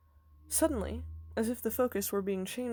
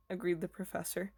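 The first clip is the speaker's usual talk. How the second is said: ordinary delivery, nothing marked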